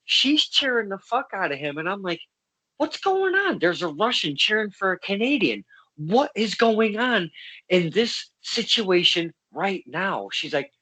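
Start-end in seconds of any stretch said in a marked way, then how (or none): 2.8-10.3 high-pitched, incredulous outburst easing into slow emphasis; mock-baffled, starting high, then spelling it out slowly; retold bewilderment. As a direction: replay your total confusion for laughs. Retold bewilderment at cheering Russian.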